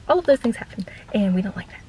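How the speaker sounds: unvoiced whispered aside; whispering. Real, not acted. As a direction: lean in and whisper it. Aside about rude guests.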